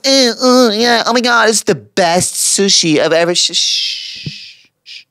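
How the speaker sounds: put-on high gushing party girl voice. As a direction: squeal like a sushi-obsessed partygoer. Imitating Tel Aviv sushi lovers.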